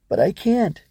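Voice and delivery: put-on high, helpless child's protest. Ava is told to fight shadows, protesting. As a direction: plead that it's beyond you.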